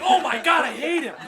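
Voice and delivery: loud laughing cry of frustration; exasperated outburst. Retold or imitated. Retelling how the teasing got to him, a loud laughing outburst. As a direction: burst out, laughing and fed up. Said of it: "And you're like".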